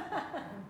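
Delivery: a quick high laugh at his own joke; high burst of laughter. Real, not acted. Preacher laughing at his misquoted Adlai Stevenson line. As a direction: crack up briefly, high and light.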